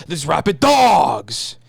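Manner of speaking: slow, drawn-out quote; movie-line impression. Friend quotes a line to identify the actor, drawn-out delivery. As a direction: stretch the last word dramatically.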